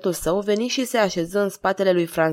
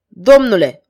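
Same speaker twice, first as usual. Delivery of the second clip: a sharp, much louder summons; commanding call; 9 dB louder than usual. Acted. King commands the lieutenant, loud and sharp. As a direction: bark the word like an order. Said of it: "ordonă".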